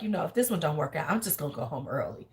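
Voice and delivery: sighing she'll go home; mock quiet resignation. Mimicking her giving up on the date, softly. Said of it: "she's like".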